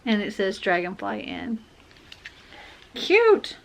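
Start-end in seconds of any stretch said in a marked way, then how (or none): 2.9-3.6 delighted squeal. Real, not acted. Delighted at gift item.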